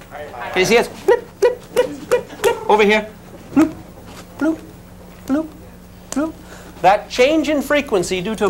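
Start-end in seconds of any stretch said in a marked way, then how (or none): 0.9-2.7 quick, high-pitched blip sounds; high little blips in quick succession; high-frequency blips. Shown as a demonstration. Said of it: "higher frequency or lower". 3.5-6.3 spaced-out bloop sounds; one short bloop at a time, evenly spaced; low-frequency bloops. Shown as a demonstration. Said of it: "higher frequency or lower".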